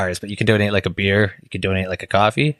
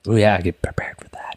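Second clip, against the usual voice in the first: hushed whisper; low, breath-only mock-ASMR whisper; ASMR style; 4 semitones lower than usual. Shown as a demonstration. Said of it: "whole episode A S M R style".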